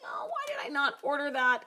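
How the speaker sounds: high-pitched imitation of regretful customers; mock regret. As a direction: put on a high voice of someone kicking themselves. Voices people regretting not ordering.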